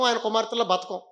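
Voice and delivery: normal talking voice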